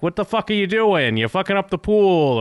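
put-on a father snapping at the kid in the pool; angry yell. Tony yelling at A J in the pool, a retold angry yell.

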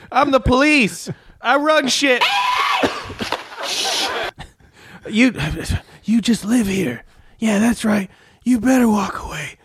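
high, heated police boast; Denzel rant impression. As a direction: strut and threaten the crowd. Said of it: "Denzel Washington's character".

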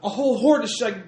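high, loud, breathless panic; panicked caller. Acted. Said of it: "it says".

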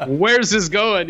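loud, slow, high "where's this going?"; a dad bracing for what comes next; alarmed dad. Retold or imitated. Dad alarmed by son's confession.

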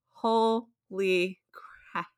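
slow, stunned disbelief; open-mouthed shock. Retold or imitated. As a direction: drag it out, jaw hanging in shock. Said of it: "sitting there with my mouth open like".

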